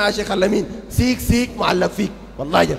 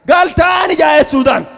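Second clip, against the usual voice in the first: loud, raised, indignant preaching cry; indignant shout; 4 semitones higher, 8 dB louder than usual. Acted. Preacher to the crowd about the sheikh's return, shouting in outrage.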